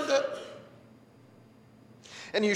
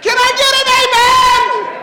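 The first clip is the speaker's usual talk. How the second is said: loud, high preacher's call to the room; rally the congregation; call for amen; 13 semitones higher, 10 dB louder than usual. Real, not acted.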